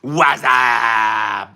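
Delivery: loud drawn-out what's up yell; shouted greeting. Acted. Streamer greets the chat with a loud yell. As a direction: call it out like a hype man.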